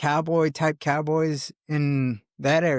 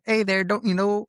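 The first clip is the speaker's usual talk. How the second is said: high, put-on Michigan accent; imitated Midwest accent; 8 semitones higher than usual. Imitating a buddy from Michigan slipping into his Midwest accent. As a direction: do a Fargo-style Midwestern greeting. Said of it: "kinda has that type of accent".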